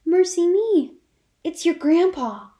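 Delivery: high, loud cry of alarm; alarmed exclamation. Acted. Jo hears the bell and fears grandpa, alarmed cry. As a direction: yelp it as the bell rings. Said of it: "exclaiming with alarm".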